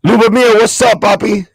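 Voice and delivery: loud, high greeting to a viewer; hyped, loud hello to a fan; loud shout-out. Real, not acted. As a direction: call his name out across the room.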